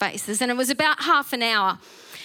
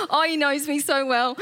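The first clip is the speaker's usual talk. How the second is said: moved; 3 semitones higher, 3 dB quieter than usual. Real, not acted.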